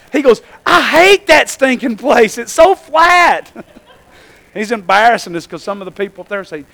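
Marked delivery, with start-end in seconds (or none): 0.6-3.5 slow, raised-pitch imitation of a disgusted boy; complaining kid. Preacher imitates son griping about Indiana, complaining kid. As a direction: drag it out as a kid's loud gripe. Retelling son's loud outburst.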